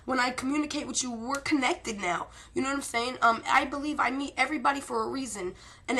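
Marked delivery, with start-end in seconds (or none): none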